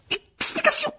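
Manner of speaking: one-word order, voice jumping up; stern demand. A narrator's reading. The stern professor demands an explanation from the boys, sharply. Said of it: "ajouta-t-elle".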